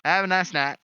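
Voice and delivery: loud, high sign-off; calling goodbye. Acted. Player saying goodbye to his companion, loud call. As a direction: call it out as you leave.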